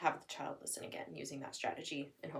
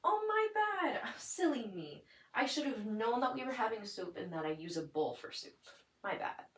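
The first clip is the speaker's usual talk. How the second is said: much higher hammed-up oops voice; playful mock apology; 4 semitones higher than usual. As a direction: play the silly mom, overdone. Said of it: "So then I would be".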